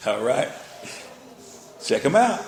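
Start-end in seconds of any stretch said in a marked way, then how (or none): none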